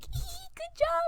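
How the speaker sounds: very high, squeaky congratulation; giggly high-pitched mock praise; mimicked friends.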